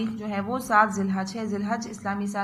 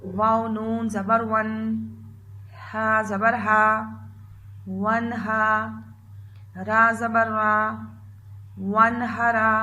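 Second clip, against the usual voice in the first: slow spelling drill; much slower than usual. Shown as a demonstration. Teacher drilling children through a word, slow spelling. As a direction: spell it out slowly so children repeat. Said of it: "तो शुरू करते हैं।".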